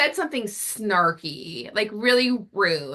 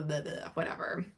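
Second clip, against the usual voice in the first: trailing off into an unvoiced throwaway mutter; mumbled dismissal; 3 semitones lower, 12 dB quieter than usual. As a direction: let it fade to a bored breathy mumble. Husband's dismissive excuse retold.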